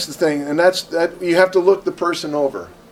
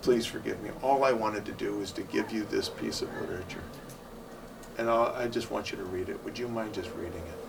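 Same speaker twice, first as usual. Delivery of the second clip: softer, lower, humble request; gentle plea; 4 semitones lower, 10 dB quieter than usual. Retold or imitated. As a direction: ask kindly, hushed. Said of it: "I said".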